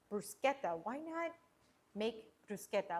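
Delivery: ordinary talk, nothing marked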